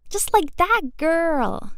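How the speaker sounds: high, loud, drawn-out cheer; slow, whooping approval. A narrator's reading. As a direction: stretch it out as excited encouragement. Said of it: "she cheers".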